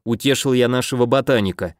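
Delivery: usual speaking voice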